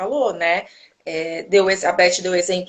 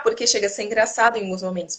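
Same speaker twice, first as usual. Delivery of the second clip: usual speaking voice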